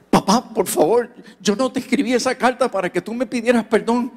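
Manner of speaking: eager son protesting; pleading son. Retold or imitated. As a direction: rush to stop him.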